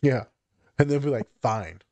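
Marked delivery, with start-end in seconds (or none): 1.4-1.8 sulky. Retold or imitated. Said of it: "Give me attitude".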